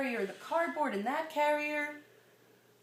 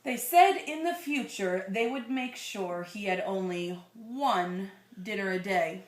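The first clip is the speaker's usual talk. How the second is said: put-on slow, gentle read-aloud to a child; storybook reading voice; story narration; 3 semitones lower, 5 dB louder, slower than usual. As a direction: read it like a bedtime picture book. Reading picture book to child.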